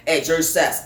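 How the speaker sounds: usual speaking voice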